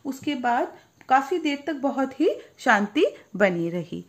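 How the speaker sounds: ordinary delivery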